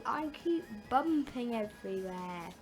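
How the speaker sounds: ordinary delivery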